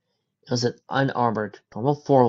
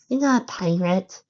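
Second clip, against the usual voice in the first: high-pitched, slow little filly voice; 8 semitones higher than usual. A narrator's reading. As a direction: play a small filly, voice lifted high.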